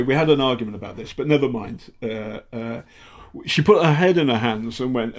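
ordinary delivery

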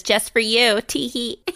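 put-on simper it like a coy girl; mock giggly girl. Recapping the heroine, mock coy giggle. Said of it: "And she says".

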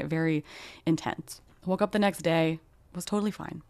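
ordinary talk, nothing marked

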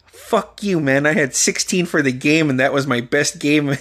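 ordinary delivery

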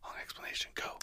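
voiceless teasing whisper. Real, not acted. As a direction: whisper it like a stage cue. Teasing prompt to continue.

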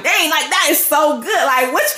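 giddy, high-voiced astonishment; excited disbelief. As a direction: react like you can't wait for what's next. Acting excited reaction during quiet time.

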